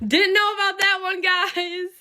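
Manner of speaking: high, drawn-out sing-song; sing-song aside. Real, not acted. Vlogger confessing to followers about another girl, sing-song. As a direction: toss it out high and stretched.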